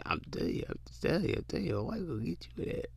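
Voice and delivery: whispered joking warning. Real, not acted. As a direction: breathe it out, no voice, teasing. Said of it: "whispered joking warning".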